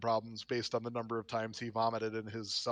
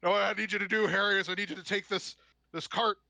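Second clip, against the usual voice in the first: put-on town drunk; 6 semitones higher than usual. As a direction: ramble it like a drunk. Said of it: "all drunk and slurring his speech".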